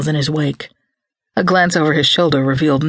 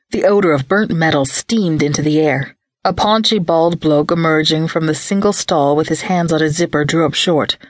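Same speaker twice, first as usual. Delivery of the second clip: usual speaking voice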